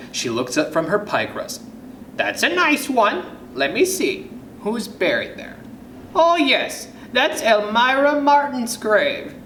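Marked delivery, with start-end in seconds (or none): 2.2-9.3 high-pitched musing granny; high, lilting grandma voice; grandmother voice. Acted. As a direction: recall it aloud as an old storybook grandma, pitched up. Grandmother recalling whose grave it is.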